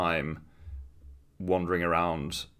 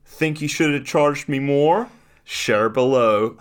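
mock smug dad; 7 semitones higher, 8 dB louder, slower than usual. Reading out a dad's post.

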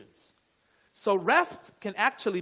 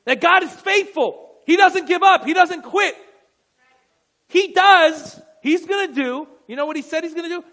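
loud, raised-pitch sermon declaration; fervent preaching; 7 semitones higher, 10 dB louder than usual. Real, not acted. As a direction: drive each promise home to the congregation.

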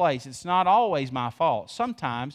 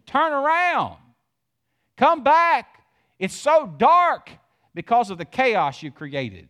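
much higher and louder, God calling out; preaching at full force; 7 semitones higher, 7 dB louder, slower than usual. Acted. Preacher voices God calling Israel home, much higher and louder. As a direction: cry out like God pleading with his lost people.

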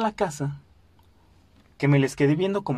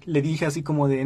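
ordinary talk, nothing marked

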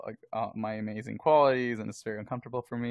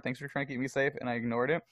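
ordinary talk, nothing marked

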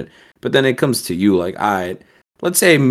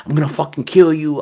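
half-volume imitation of a screamed threat; mock screaming threat; 7 semitones higher than usual. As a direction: imitate someone screaming threats, held back. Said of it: "So he starts screaming".

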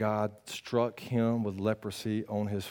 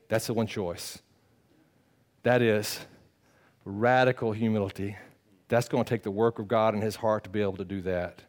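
normal talking voice

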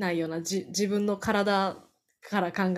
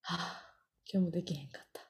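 sigh, then mutter it low and defeated; a deflated groan of self-blame; dejected sigh; 3 semitones lower, 10 dB quieter, faster than usual. Retold or imitated. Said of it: "みたいな". (落ち込んだため息まじりの声)

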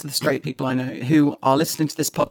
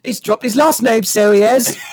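hammed-up mock cockney accent, pitched higher; 6 semitones higher, 6 dB louder than usual. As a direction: play a Mary Poppins chimney sweep in a cockney accent.

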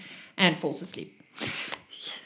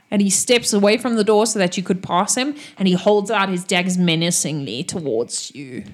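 usual speaking voice

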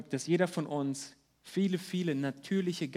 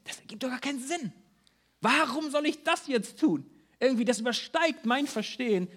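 higher, quick, puzzled protest; fast, higher, baffled objection; doubting inner voice; 6 semitones higher than usual. Retold or imitated. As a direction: play the skeptic who can't see the sense. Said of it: "wenn du dir denkst".

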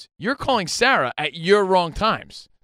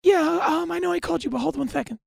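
high put-on wife voice answering; wife's sweet phone voice; mimicking wife; 12 semitones higher than usual. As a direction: lightly play the wife picking up the phone.

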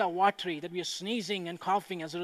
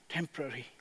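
soft echo; 5 semitones lower, 8 dB quieter, faster than usual. Real, not acted. Single word echoed softly.

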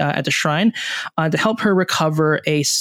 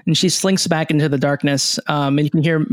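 ordinary talk

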